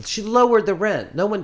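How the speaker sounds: high, loud disbelief; amazed. Real, not acted. Telling a friend about the landlady, amazed and loud.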